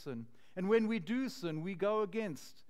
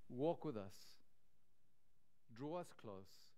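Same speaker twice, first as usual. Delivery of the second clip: closing prayer; 3 semitones lower than usual. Acted. Pastor prays for his listeners, softening to a hush. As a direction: pray it back to the Lord, letting it die away.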